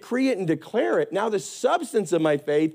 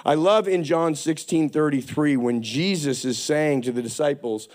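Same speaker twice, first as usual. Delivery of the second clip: ordinary talk, nothing marked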